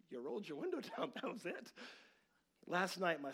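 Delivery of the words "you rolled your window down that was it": high, laughing ribbing of his dad; chuckling at his dad's mistake; laughing tease. Real, not acted. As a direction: giggle through the told-you-so.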